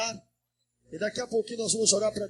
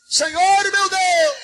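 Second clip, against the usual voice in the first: high, drawn-out fervent cry; full-voiced prayer shout; 9 semitones higher, 7 dB louder, slower than usual. Shown as a demonstration. Pastor urging the church to shout with faith, high drawn-out cry. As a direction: cry it out higher to pull the crowd along. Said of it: "Grita comigo".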